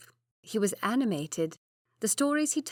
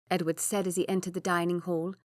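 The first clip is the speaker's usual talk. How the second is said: ordinary delivery, nothing marked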